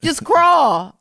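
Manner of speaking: very high, loud, slow mock-coaxing auntie; hammed-up high auntie cheering her on; aunt's voice. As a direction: coo it like a fussing aunt. Aunt voiced, continuing.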